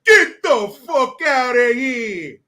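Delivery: loud, slow, drawn-out tough-guy yell; hammed-up mobster shout; mock tough shout. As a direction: bark it like a movie gangster. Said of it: "I look at the guy and say".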